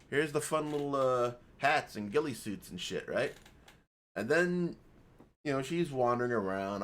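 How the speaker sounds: ordinary talk, nothing marked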